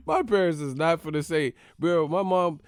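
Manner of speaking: ordinary talk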